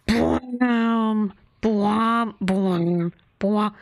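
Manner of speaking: a booming "bwam" sound effect, said over and over; imitated bomb effect. Streamer imitating the song's bomb sound effect. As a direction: mouth the movie explosion sound. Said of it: "there's a sound in there that goes".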